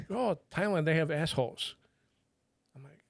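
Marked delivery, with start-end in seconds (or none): 0.1-1.8 higher mock Thai accent, pitched up; imitated Thai accent. Imitating the Thai lady explaining bidets, high mock accent.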